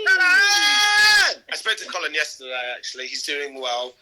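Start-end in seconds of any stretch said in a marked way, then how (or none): 0.1-1.4 long, high, loud shout of the name; calling shout. Real, not acted. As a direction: yell across the house for Colin. Calling out for Colin.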